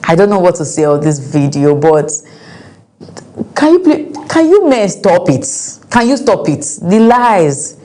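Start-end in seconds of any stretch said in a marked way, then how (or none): 3.5-7.8 high-pitched exasperated appeal to men; exasperated plea. Real, not acted. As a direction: plead with them, pitch jumping up. Scolding cheating men after the video.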